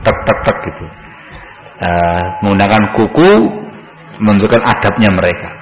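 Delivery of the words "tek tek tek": low, softer fingernail taps, spoken; polite soft knocking. Shown as a demonstration. Showing how the companions knocked with a fingernail, soft tapping. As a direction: imitate light tapping on a door. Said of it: "tidak disuarakan dengan keras gitu".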